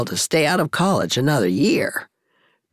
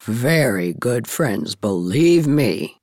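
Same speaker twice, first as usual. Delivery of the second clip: put-on low, slow, weighty older woman; emphatic; 8 semitones lower, slower than usual. A narrator's reading. Miss Cornelia insisting to Mrs. Blythe, low and emphatic. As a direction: draw out each word low and firmly. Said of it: "said Miss Cornelia emphatically".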